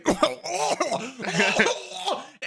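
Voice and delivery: mock choking and gagging noise; imitated choking. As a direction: act out someone choking on food. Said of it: "He fucking chokes".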